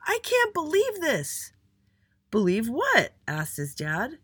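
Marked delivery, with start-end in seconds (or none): 0.1-1.5 very high, slow outraged scream. A narrator's reading. Little Andy storms in at home, screaming. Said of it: "and screamed". 2.3-3.1 high, loud startled question; puzzled. A narrator's reading. Dad asks his screaming son, puzzled.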